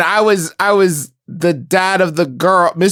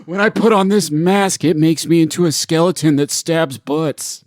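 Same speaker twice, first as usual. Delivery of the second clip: hammed-up pitch; 3 semitones lower than usual. Acting King pitching story.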